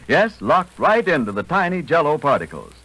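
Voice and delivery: ordinary talk, nothing marked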